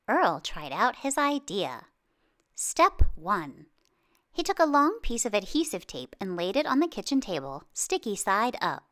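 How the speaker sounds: plain reading voice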